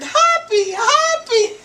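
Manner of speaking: high, loud drawn-out holler; hollered warning. Retold or imitated. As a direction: holler a desperate warning at the movie screen, imitating an excited viewer. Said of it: "Mister Wright jumped up and hollered".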